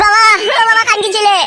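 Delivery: high yelled call across the house. Acted. Son shouting for his father who won't wake. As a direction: yell for father, then an angry demand.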